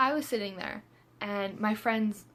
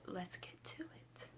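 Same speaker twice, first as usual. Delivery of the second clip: soft whisper, slower; whispered aside; 16 dB quieter, slower than usual. Real, not acted. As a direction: whisper it like a secret. Aside to viewers.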